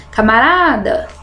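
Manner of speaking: "Camarada" with RA stretched like a call; mock call, long RA in "Camarada"; calling out the word. Shown as a demonstration. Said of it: "chama ele".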